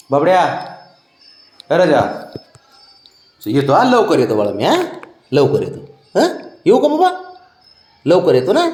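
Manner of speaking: high, loud sing-song coaxing. Real, not acted. Man coaxing his sulking pet before leaving, high baby talk. Promising pet to return soon.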